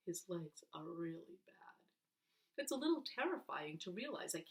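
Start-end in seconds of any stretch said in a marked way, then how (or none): 0.1-1.7 whispered softly; grieving whisper about a friend; sad whisper. A narrator's reading. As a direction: hush it with sorrow. Sad aside about Paul.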